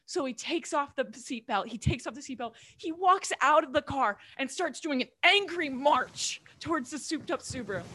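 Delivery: usual speaking voice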